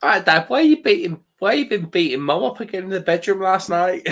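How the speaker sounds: put-on cheeky Scouse kid grilling his dad; mock Scouse kid. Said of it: "why has he got a Scouse kids".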